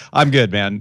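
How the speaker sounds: ordinary delivery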